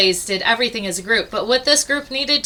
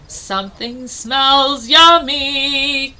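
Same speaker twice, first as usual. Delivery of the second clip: slow, louder hungry growl; growling bear; 3 semitones higher, 4 dB louder, much slower than usual. Acted. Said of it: "growled the bear".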